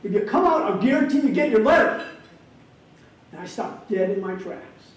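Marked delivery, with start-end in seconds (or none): 0.0-2.1 higher and louder, calling down the track; loud, high call across a field; retold shout. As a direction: play the coach calling after a running kid. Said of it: "he shouts out".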